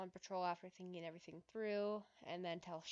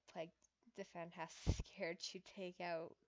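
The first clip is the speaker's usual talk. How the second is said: usual speaking voice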